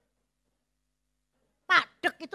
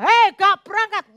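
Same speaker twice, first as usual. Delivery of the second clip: mild mock holler, pitched up; retold calling out; 5 semitones higher, 4 dB louder than usual. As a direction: imitate someone calling to a hard-of-hearing partner. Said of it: "Tadi diceluk".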